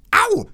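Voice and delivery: kurzer, sehr hoher Aufschrei vor Schmerz; schriller Schmerzensschrei. Acted. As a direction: quiek auf, als würde man gebissen. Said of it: "sagte das Weib zur gleichen Zeit". (ins Ohr gebissen: kurzer, sehr hoher Schmerzensschrei)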